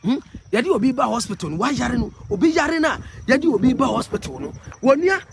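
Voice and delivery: loud and high, complaining; heated loud rant about the road. Real, not acted. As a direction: call out over the road noise, worked up. Agitated vlogger shouting on the road.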